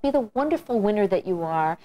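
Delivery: usual speaking voice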